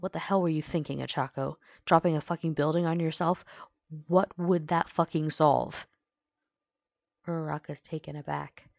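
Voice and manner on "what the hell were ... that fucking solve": loud, heated scolding; grinding anger. A narrator's reading. Bakugo scolds Ochako for dropping a building, grinding it out. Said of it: "Bakugo grinds out".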